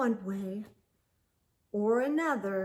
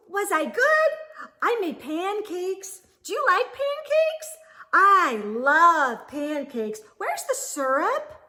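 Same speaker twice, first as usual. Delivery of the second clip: high, loud, eager chirping; excited chatter; 9 semitones higher, 8 dB louder than usual. Acted. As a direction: babble like a thrilled little bird. Early Bird chatters happily.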